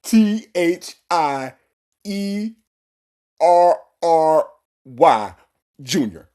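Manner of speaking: slow, loud letter-by-letter imitation; mock his laboured spelling, one drawn-out letter at a time. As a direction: spell it out slowly with long pauses. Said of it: "I'm gonna spell it for you one more time".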